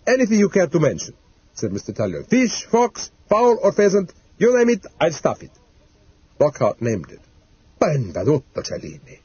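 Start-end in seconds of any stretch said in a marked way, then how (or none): 0.1-1.2 boastful. Acted. Taxidermist boasting to customer. 2.3-5.5 high, rattled-off sales patter; eager boasting. Acted. Taxidermist selling his skills to a caller, high and boastful. 7.8-9.2 Italian exclamation. Acted. Italian taxidermist stunned by the request, hushed Italian exclamation. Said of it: "lapsing into his native tongue".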